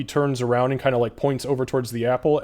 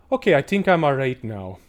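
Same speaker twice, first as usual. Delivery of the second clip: Mundi's voice; 3 semitones higher, 5 dB louder than usual. Acted.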